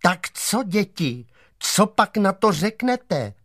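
a hint of a chorus mimicking the teacher; everyone echoing teacher. Zoo animals repeat the teacher's question to the kids, lightly mimicking. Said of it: "opakovali po soudružce učitelce".

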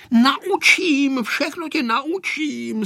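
put-on high, drawn-out boyish promise; bursting start, stretched-out assurance; eager promise. A narrator's reading. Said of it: "sliboval Vojta".

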